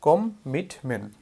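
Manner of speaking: কম মিট মেন said slowly, a pause between each part; teacher sums up the memory trick slowly; slow syllables. Shown as a demonstration. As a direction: break কম মিট মেন into slow separate beats. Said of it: "M E N T মেন".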